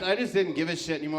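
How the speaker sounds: normal talking voice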